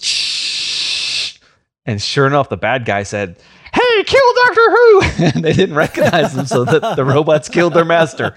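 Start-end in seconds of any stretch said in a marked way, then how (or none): 0.0-1.4 unvoiced hiss of escaping gas. Doctor Who opening the gas valve, an unvoiced hiss. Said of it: "opens the valve and goes". 3.7-5.0 squeaky helium-high command, much louder. Retold or imitated. The bad guy orders robots in a squeaky helium voice. Villain's helium voice in retold scene.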